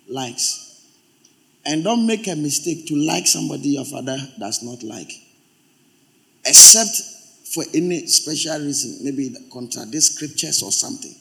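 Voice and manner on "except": high, loud single word; sudden shout. Real, not acted. Preacher cutting in on himself, sudden shout. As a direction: bark it out as a caveat.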